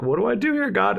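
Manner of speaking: high mock-panicked plea. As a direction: plead in a panic. Retells panicked prayer.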